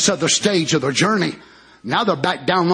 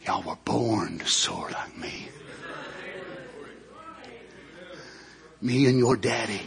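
mother eagle; 9 semitones lower, 7 dB quieter than usual. Acted. Mother eagle voiced in story.

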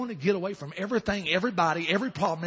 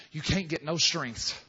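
ordinary talk

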